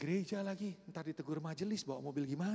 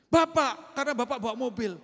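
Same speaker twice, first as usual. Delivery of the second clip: much higher, loud accusation; man shouting that the driver is guilty; loud blaming; 5 semitones higher, 10 dB louder than usual. Retold or imitated. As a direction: shout the blame at him. Man blames pastor loudly.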